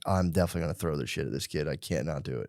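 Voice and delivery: low, quiet talk to yourself; retold grim vow, softly; muttered resolve.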